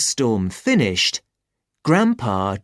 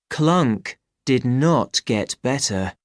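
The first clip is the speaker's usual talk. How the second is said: plain reading voice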